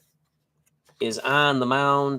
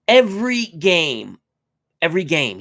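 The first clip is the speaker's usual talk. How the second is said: amazed emphasis; 5 semitones higher, 5 dB louder than usual. Real, not acted. Amazed at viewer's project.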